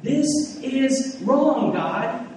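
high, slow, drawn-out whine at God; Jonah complaining. Retold or imitated. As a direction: stretch out the protest like a sulky child. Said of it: "he starts saying something like".

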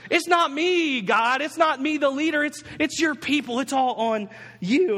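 high, hammed-up blame-shifting whine; pity-party protest. Preacher playing Moses blaming God, whiny and defensive. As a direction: protest high and aggrieved, slowing at the end. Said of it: "he's having this party".

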